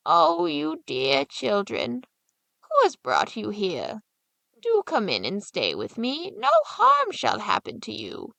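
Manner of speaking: put-on slow, sugary old woman coaxing the children in; sweet-talking old witch. Old witch luring children into her house, in a slow sweet coaxing voice. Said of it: "nodded her head and said".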